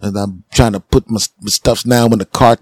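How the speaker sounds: ordinary talk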